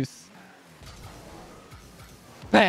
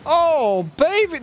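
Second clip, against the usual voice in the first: higher, thrilled; high excited cheer; 9 semitones higher, slower than usual. Real, not acted. Gamer praising a teammate's pass, high cheer.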